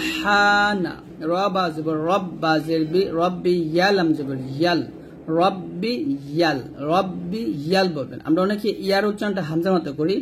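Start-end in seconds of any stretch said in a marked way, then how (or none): none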